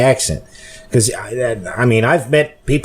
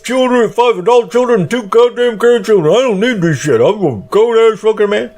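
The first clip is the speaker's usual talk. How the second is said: much higher, louder mock rant of a fed-up coworker; imitating angry coworker; 12 semitones higher, 4 dB louder than usual. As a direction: ham up the furious quitting rant, shrill and loud. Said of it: "bitching and complaining to somebody else".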